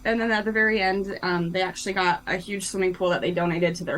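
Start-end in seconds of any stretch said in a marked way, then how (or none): none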